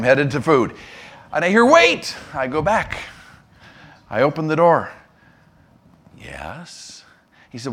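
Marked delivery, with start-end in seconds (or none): 1.7-2.2 very high, comic call to come back; exaggerated high-pitched mock holler. Pastor halting intern on the food run, calling out. 6.2-7.0 very low, hushed acted reply; restrained patience. Intern to pastor who called him back, restrained patience.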